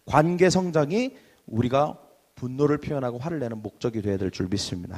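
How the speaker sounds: ordinary talk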